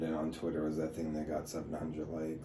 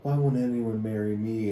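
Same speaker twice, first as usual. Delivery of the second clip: louder mocking copy of her question; hammed-up plea, pushed louder; mock plaint; 3 semitones higher, 6 dB louder than usual. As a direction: parody her post, loud and playful. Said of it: "And I was like".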